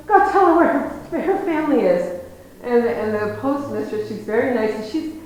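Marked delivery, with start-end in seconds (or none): 0.1-2.2 put-on a loud, insistent old farmer; high, loud urging. Old farmer urging the postmistress to help her, high and loud. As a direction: press the postmistress to tell her, loud and eager.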